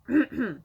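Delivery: real throat clearing. Real, not acted. Clearing the throat mid-rant, real throat clear. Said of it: "I don't have covid".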